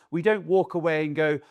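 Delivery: ordinary talk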